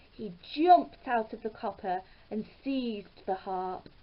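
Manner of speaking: plain reading voice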